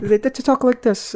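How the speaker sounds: lightly mock a posh British way of talking; mock British accent. Mocking fighters' British accents to his co-host, slow mock British accent. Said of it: "they both talk like they're British".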